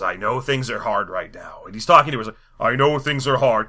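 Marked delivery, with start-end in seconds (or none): 0.0-1.6 low, slow, soft gravity; mock-grave deep murmur; Christopher Lee. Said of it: "he's kind of a Christopher Lee type". 2.6-3.7 fuller-voiced mock-grand villain; put-on solemn, commanding tone; Christopher Lee. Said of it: "he's kind of a Christopher Lee type".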